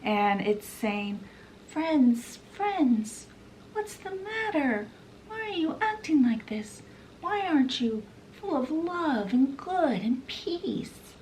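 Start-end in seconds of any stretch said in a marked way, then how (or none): 1.7-11.1 high, hushed, soothing croon; soft tender voice. Retold or imitated. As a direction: imitate a gentle loudspeaker voice pacifying a crowd. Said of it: "that same kind of soft, tender voice".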